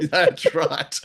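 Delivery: giggling yes; laughing agreement. Real, not acted. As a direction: chuckle through the words. Laughing at PR joke.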